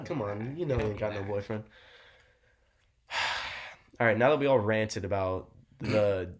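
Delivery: ordinary talk, nothing marked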